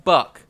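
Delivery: "buck" with an uh vowel; pronounced like buck. Retold or imitated. Retelling Auntie's claim, uh-vowel "buck". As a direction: say "buck" to rhyme with luck. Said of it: "it was pronounced like buck".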